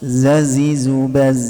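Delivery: "Zazizubaz" chanted slowly on a level tone; drawn-out drill of "Zazizubaz"; sound let out. Shown as a demonstration. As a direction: let the z buzz through a long "Zazizubaz".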